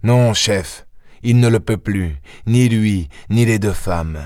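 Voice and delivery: lightly voiced eager police inspector; a hint of the keen detective reporting; inspector Ganimard. Ganimard reports to his chief, lightly voiced.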